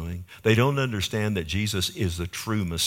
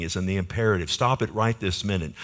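normal talking voice

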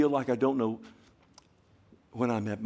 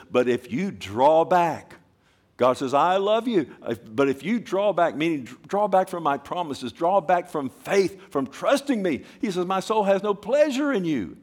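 high, loud fervent warning; preaching at full force; 8 semitones higher, 6 dB louder than usual. Acted. Preacher voicing God warning those who draw back, fervent. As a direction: plead then warn.

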